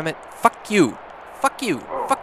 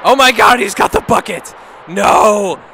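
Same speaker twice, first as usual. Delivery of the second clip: excited shout; 4 semitones higher, 8 dB louder than usual. Real, not acted. Panicked reaction to the game.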